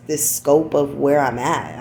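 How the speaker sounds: usual speaking voice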